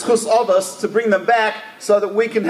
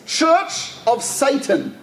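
drawn out, higher, every word weighed; slow dramatic reveal; 5 semitones higher, much slower than usual. Retold or imitated. Reading a stranger's medallion aloud, slow and stunned. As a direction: let "Church of Satan" land slowly, astonished. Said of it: "And on the medallion it says".

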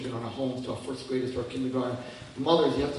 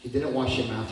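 ordinary talk, nothing marked

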